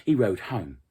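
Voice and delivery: plain reading voice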